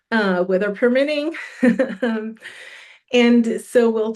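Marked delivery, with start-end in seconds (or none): 1.4-2.0 a quick, light chuckle; short laugh. Real, not acted. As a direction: laugh it off briefly. Joking about the weather.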